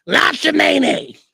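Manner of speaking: put-on frail old lady voice. At Subway, the lady in the wheelchair insists, croaking slowly. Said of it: "this is exactly how she sounded".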